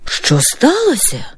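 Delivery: mild alarm, looking up from sewing; startled. Acted. Mother startled by her daughter's shout, mildly alarmed question. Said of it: "Злякалася Євгенія Петрівна".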